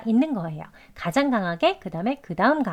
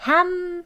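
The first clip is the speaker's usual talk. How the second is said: "ham" high and drawn out; strongest stress; 9 semitones higher than usual. Shown as a demonstration. Teacher showing the main stress, "ham" high and long. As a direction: lift "ham" high and hold it. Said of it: "가장 또 강하게 발음되어지는데요".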